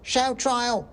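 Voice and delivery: very high squeaky cry; a tiny mob member yelling; shouting mouse. Acted. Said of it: "shouted another voice".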